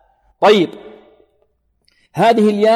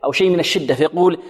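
normal talking voice

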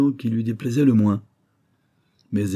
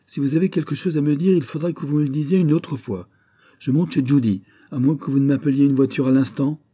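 defiant; 6 semitones higher, 5 dB louder than usual. Acted. Lily defies Gus.

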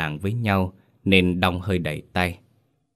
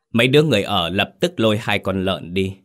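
ordinary delivery

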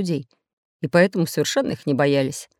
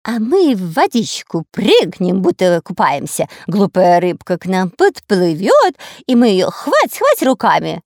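sly little pirate; 6 dB louder, slower than usual. Acted. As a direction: voice a giddy, cunning sailor. Narrator voices Дырка.

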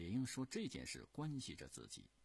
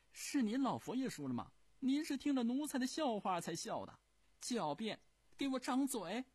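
争辩; 9 dB louder than usual. Acted. 争辩输赢.

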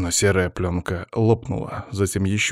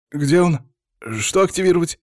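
high, louder confused questions; flustered; 9 semitones higher, 3 dB louder than usual. A narrator's reading. Partner at a loss over his guard's order, flustered. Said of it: "растерялся напарник".

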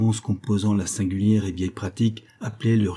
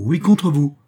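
"contre vous" thrown out higher and louder; repeated threat said louder and higher; emphatic threat; 6 semitones higher, 7 dB louder than usual. Acted. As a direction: hammer home "oui, contre vous" as a raised warning.

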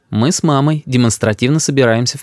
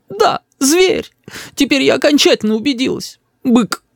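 very high, hurt tirade; high-pitched aggrieved outburst; outraged mother; 13 semitones higher than usual. A narrator's reading. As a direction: denounce your husband as a brute.

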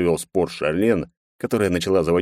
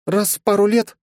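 put-on high girlish snort; snorting scoff; 4 dB louder than usual. A narrator's reading. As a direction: scoff it out, mocking. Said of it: "фыркнула Лени".

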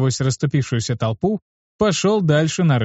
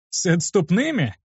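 surprised question; 6 semitones higher than usual. A narrator's reading. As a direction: pitch jumps up in disbelief. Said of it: "с удивлением спросил я". (удивлённо переспросить)

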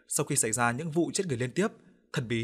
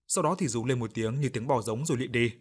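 ordinary delivery, nothing marked

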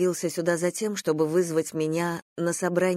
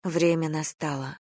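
plain reading voice

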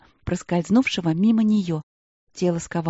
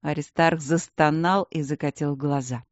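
ordinary delivery

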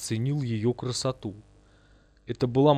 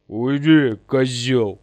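loud, rough command; barked order; 7 dB louder, much slower than usual. A narrator's reading. Бурый orders the ghost aside, loud command. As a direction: order him out of the way, loudly. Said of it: "приказал Бурый".

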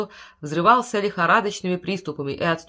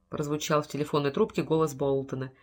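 usual speaking voice

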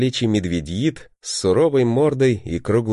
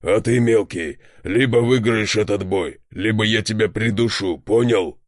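threatening bear voice. A narrator's reading.

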